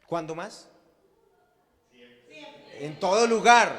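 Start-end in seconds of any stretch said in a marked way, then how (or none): 2.8-3.7 high, loud ringing shout. Acted. Pastor calling the congregation to speak everywhere, shouting. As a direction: cry it out to the room.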